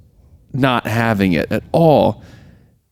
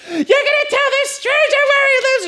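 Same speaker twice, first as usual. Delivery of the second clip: shrieky, very high imitation; mock screaming wife. Wife berating her husband, in a high mock scream. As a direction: squeal it in outraged mock fury. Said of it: "keeps screaming and threatening him".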